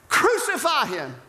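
high, forceful yell; crowd shout. Acted. Crowd answering Pilate's plea, shouting. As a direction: holler it as a demanding mob. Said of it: "they shouted all the louder".

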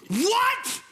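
a sudden high squeal of surprise; shocked what. Pretend. God startled by the staffer's note, high shocked squeal. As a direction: yelp it like a startled boss. God's imagined shocked reaction.

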